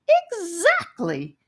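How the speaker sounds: high, slow squeaky exactly; satisfied squeak. Acted. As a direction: squeak it out, very pleased. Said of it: "Charlie squeaked with satisfaction".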